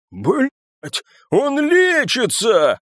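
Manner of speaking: high, drawn-out alarmed yell. A narrator's reading. Папаша seeing the wound close, yelling. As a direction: cry out in disbelief. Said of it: "заорал папаша".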